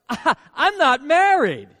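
put-on flustered young bride-to-be; startled Mary. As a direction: blurt it in embarrassed shock.

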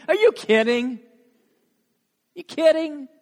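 high, loud disbelief; acting out a stunned, offended comeback; incredulous retort. Mary's imagined retort to angels.

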